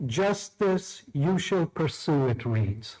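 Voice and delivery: ordinary talk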